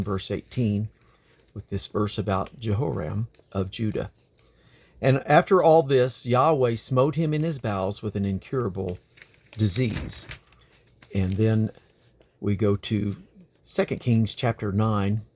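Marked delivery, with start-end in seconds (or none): 5.0-10.3 measured Bible reading voice; steady, earnest scripture reading. Acted. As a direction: read the verse like a lesson in church. Reads scripture aloud.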